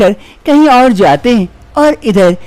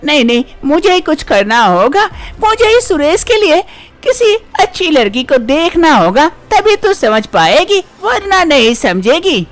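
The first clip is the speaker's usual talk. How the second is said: higher-pitched, taunting mother-in-law; nagging mother-in-law; 9 semitones higher than usual. Acted. Mother-in-law threatening her childless daughter-in-law, higher taunting voice. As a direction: needle the daughter-in-law as a scolding saas.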